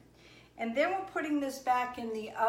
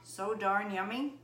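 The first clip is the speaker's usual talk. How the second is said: mouth full of hot food; 8 dB quieter than usual. Real, not acted. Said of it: "Hot, hot, hot".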